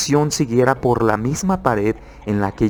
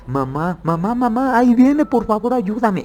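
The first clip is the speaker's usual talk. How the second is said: much higher, panicked plea as it comes closer; trapped boy screaming to mother in terror; terrified cry for mom; 10 semitones higher than usual. Retold or imitated. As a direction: shriek for mom, the face is coming. Trapped boy sees the face approaching.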